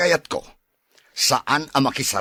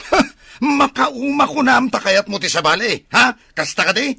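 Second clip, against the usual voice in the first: loud, high accusing shout; angry comeback; 9 semitones higher, 5 dB louder than usual. Acted. Husband shouts back at his wife in the quarrel, angry.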